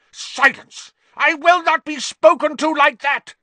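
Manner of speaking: very high, loud shriek; enraged shriek. A narrator's reading. Snape silences Harry, shrieking with rage. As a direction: shriek it, completely losing control. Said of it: "Snape shrieked".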